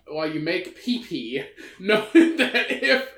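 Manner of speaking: fighting back laughter; barely holding in a laugh; trying not to laugh. Real, not acted. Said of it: "I can't keep a straight face on that one".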